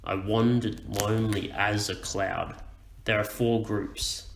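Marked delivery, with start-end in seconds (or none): 0.0-2.6 slow "I wandered lonely as a cloud", weight on WAN, LONE, AS, CLOUD; deliberate iambic reading of "I wandered lonely as a cloud"; counting the stresses. Shown as a demonstration. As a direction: recite "I wandered lonely as a cloud" slowly, marking four beats. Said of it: "count the stressed syllables".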